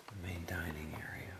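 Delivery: soft whisper, house asleep. Real, not acted. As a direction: hush it so nobody wakes. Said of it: "sorry if I'm whispering".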